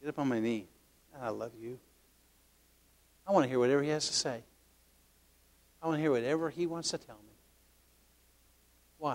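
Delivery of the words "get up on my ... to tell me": very soft, doting murmur; tender inner voice. Acted. Grandfather inviting his youngest grandson onto his knee, tender. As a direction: speak gently, as to a beloved grandchild. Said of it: "little man in my head is saying".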